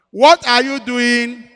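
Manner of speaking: God demanding an answer, drawn out; stern voice of God. Retold or imitated. Lord's stern question.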